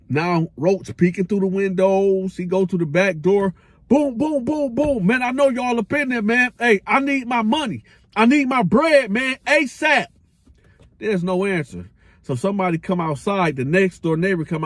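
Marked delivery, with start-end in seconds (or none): 3.9-5.1 bang out each boom high; mock knocking. Roach pounding on the back door, high booms. 5.1-10.1 higher, calling out for his money; calling through a door. Retold or imitated. Roach calling through the back door for his money.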